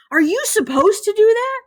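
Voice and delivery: high-pitched disbelieving question; incredulous kid. A narrator's reading. Classmate questions Ben about holding the mouse, incredulous. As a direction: ask like a scandalized classmate.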